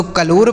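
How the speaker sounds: कलोर with a long "o" in the second syllable; wrong pronunciation. Shown as a demonstration. Teacher warns learners off a misreading, कलोर with long "o". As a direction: say कलोर as "ka-lore". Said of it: "ना तो कलोर पढ़ेंगे".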